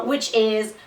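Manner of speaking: ordinary talk, nothing marked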